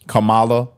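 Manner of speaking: Kamala as kuh-MAH-luh; stress on second syllable. Shown as a demonstration. Commentator giving up on the name, Kamala as kuh-MAH-luh. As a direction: punch the middle of Kamala with an open "ah". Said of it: "however you say it".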